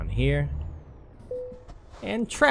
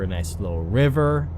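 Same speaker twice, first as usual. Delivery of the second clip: ordinary talk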